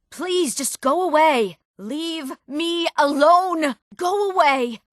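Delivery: high, drawn-out plea to be left; distressed plea. Acted. Frightened lady to her visitor, distressed, high plea. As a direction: wail each word out slowly, high-pitched. Character begs to be left alone.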